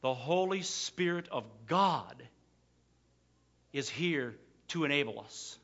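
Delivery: ordinary talk, nothing marked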